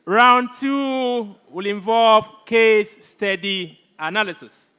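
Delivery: loud, booming results announcement; announcer voice. Acted. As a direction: proclaim the scores to the hall. Announces scores to the hall.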